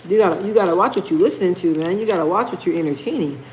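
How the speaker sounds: normal talking voice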